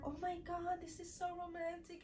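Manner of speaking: high, hushed romantic swooning; soft mock gushing. Stepmom kept gushing about the romantic beach dinner, high and hushed. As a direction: imitate a gushing stepmom under your breath.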